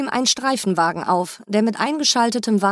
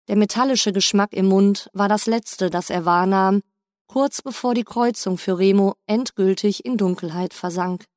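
ordinary delivery

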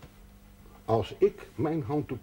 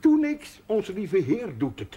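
worked-up protest; 8 semitones higher, slower than usual. Acted. Healer protesting to the chaplain, high and flustered. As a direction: voice jumps up high, stammering ik ik ik.

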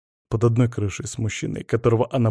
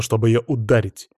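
plain reading voice

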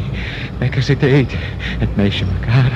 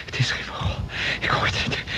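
hushed; 8 semitones lower, 8 dB quieter than usual. Acted. Dazed survivor listening to a scream.

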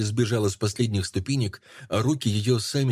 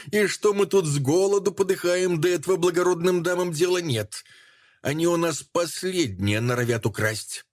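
bitter shrill woman; 9 semitones higher than usual. Acted.

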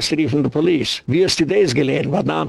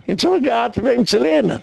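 high-pitched emphatic repeat; exclaiming; 8 semitones higher, faster than usual. Real, not acted. Preacher driving home whom they learned from, exclaiming. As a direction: drive it home in a raised pitch.

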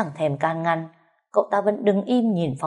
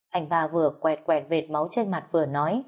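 ordinary delivery

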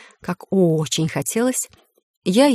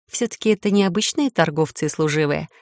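ordinary delivery

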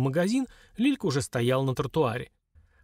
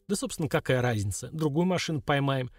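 usual speaking voice

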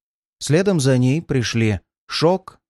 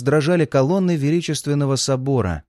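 ordinary delivery

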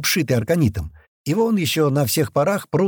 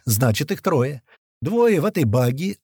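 plain reading voice

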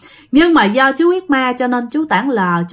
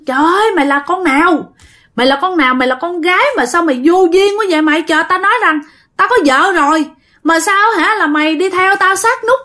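higher, fast angry yelling; mock dad yelling furiously at the intruder; retold yelling; 6 semitones higher, faster than usual. Dad yelling at the girl lying on his bed, angry and higher. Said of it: "mà ba la lên đó".

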